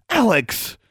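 very high exclamation, nearly shouted. A narrator's reading. Roommate stops her going to bed, near shouting. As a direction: burst out high with the name. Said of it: "Anna practically shouted".

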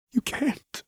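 high, hoarse pleading rasp; rasped plea. Acted. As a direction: plead through panic, barely voiced. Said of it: "Lux rasped".

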